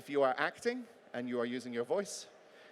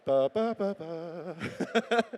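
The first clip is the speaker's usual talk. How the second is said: playful singsong ba; 3 semitones higher, much slower than usual. Acted. Playful vocal noise.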